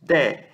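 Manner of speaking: "De" with open E; open vowel demo. Shown as a demonstration. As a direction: say De as DÉ, vowel wide. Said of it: "ela é lida aberta".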